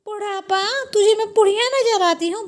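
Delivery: high, affronted questioning; offended. Acted. Mother-in-law insulted at being called old, indignant. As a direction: echo it in high-pitched indignation.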